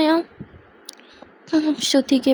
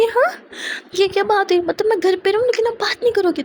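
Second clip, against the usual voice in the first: indignant retort; 8 semitones higher than usual. Acted. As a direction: act offended at an unfair deal.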